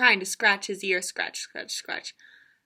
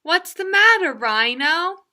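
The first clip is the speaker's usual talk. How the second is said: lightly voiced birdie; 6 semitones higher, 6 dB louder than usual. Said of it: "he said to the rhino".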